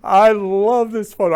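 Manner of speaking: loud, high burst of delight. Real, not acted. Host reacting to mom's photo of her kids, delighted. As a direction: gush loudly over it, pitched high.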